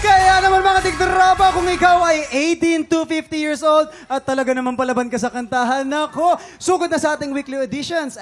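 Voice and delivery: loud, energetic ad read; promo read. Acted. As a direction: hype up the auditions.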